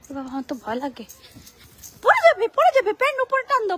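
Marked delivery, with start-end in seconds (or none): 2.0-3.8 high, louder urgent warning; anxious warning. Real, not acted. Mother warning her climbing son, anxious and higher. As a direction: call out fast before he slips.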